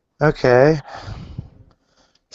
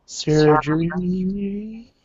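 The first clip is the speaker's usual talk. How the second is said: slow, distant echo of the word; 4 semitones higher, 5 dB quieter, much slower than usual. Real, not acted. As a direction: let it ring back like an echo. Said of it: "Why do I keep hearing myself?".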